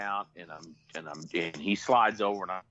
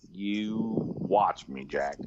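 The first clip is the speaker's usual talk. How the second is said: very slow, deliberate retold challenge; cocky defiance; 2 semitones lower, much slower than usual. Answering his doubting buddy, slow cocky defiance.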